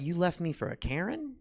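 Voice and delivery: high-pitched disbelief; incredulous. Retold or imitated. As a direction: squeak it out in disbelief. Punchline disbelief at ex-wife.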